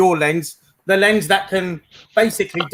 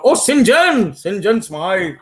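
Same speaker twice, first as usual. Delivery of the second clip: mock upper-class English twang, pitched up at the start; lightly voiced posh golfer; 4 dB louder, much slower than usual. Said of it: "he speaks it in a strange twang".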